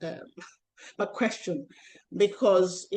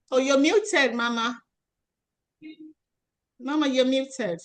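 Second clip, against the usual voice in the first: higher, louder call to a muted guest; 6 semitones higher, 5 dB louder than usual. Real, not acted. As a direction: call out to get her attention.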